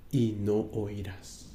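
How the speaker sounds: soft, slow and dropped low, almost breathed; hushed emphasis. Shown as a demonstration. Preacher hushing the congregation, soft and slow. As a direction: let "y no oirás" fall away quietly and slowly. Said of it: "pero escuche esto".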